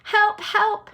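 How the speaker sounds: high, loud cry for help; boy crying for help. Acted. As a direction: call out desperately. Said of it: "he cried".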